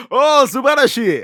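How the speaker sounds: high, loud mock Japanese exclamation; Japanese accent. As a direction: gush it in a hammed-up Japanese accent. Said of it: "I don't know why I put the accent".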